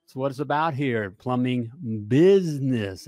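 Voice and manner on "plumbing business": "business" drawn out and punched; slow down and lean hard on "business"; stress on BUSINESS. Real, not acted. Host telling plumbers what it's about, stress on "business". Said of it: "emphasis on business".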